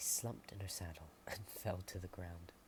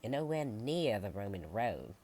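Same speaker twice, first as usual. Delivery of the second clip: much louder, matter-of-fact farmer; blunt farmer; 2 semitones higher, 10 dB louder than usual. Acted. Farmer Sally telling the lost visitors they're far off. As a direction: set them straight, firmly.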